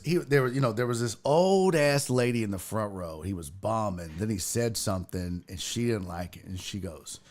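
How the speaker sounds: ordinary talk, nothing marked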